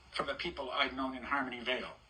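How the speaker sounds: ordinary delivery, nothing marked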